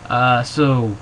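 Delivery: usual speaking voice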